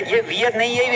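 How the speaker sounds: ordinary talk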